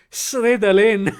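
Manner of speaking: Slytherin said slowly as "Sredalin"; L and R swapped. Shown as a demonstration. Working out a terrible Chinese accent, Slytherin as "Sredalin". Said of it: "switch the L's and the R's".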